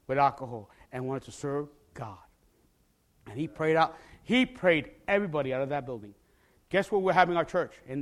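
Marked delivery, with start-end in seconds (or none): none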